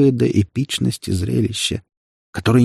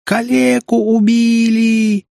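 very high, drawn-out screech; hysterical shriek; 13 semitones higher, 4 dB louder, much slower than usual. A narrator's reading. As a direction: shriek it to the whole crowd. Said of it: "истерически завизжала какая-то женщина". (истерический визг)

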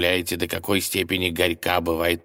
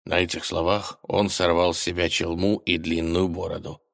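ordinary delivery, nothing marked

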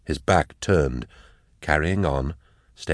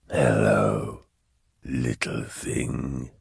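deep, very slow condescending demon; demon's greeting; 4 semitones lower, much slower than usual. A narrator's reading. Cherubael looks down at Eisenhorn, slow menacing greeting.

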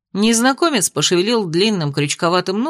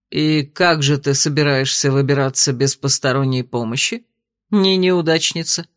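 mocking man; 5 semitones lower than usual. A narrator's reading. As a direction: lower teasing man's voice. The mocking rescuer.

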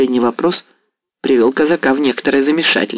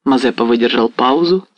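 plain reading voice

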